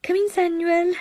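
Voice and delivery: high, loud call from the landing; a sister calling down to her brother. Acted. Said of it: "Miss Evans called from the landing".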